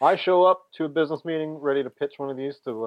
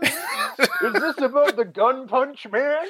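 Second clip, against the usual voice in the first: high, slow, laughing exec; weary exec voice; 12 semitones higher, 3 dB louder, much slower than usual. Pretend. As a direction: drag it out, tired and amused. Mock exec on the phone.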